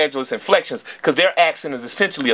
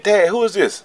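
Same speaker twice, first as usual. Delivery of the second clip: lightly voiced young boy, higher pitch; young son; 6 semitones higher than usual. Son asking Dad about the music in the car, lightly voiced child. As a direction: imitate a curious kid asking, lightly. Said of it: "seven, eight years old".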